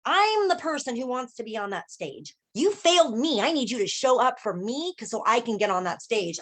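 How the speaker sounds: put-on high, forceful child-like protest; indignant inner child. Inner child tells her she failed her, high indignant demand. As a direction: stand up for yourself in a high, insistent voice. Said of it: "She was like".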